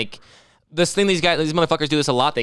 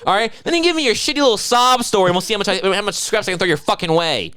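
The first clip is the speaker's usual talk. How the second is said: mock angry tourist; 7 semitones higher than usual. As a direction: snap at the vendor, hammed-up.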